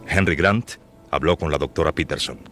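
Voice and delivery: ordinary talk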